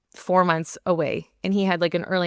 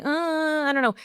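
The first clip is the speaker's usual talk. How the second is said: whiny; 9 semitones higher than usual. Retold or imitated. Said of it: "whiny energy".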